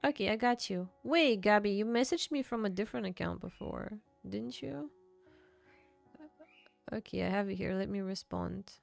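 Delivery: normal talking voice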